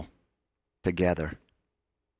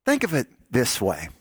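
ordinary talk

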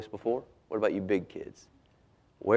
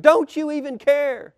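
high, loud desperate cry; shrieked reproach; 11 dB louder, slower than usual. A narrator's reading. Fishermen reproaching Jesus during the storm, shrieked. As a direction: cry out in accusing panic. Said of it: "they shrieked".

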